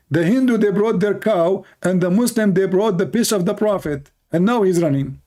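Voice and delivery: high, sing-song mockery of gloating critics; mocking hecklers.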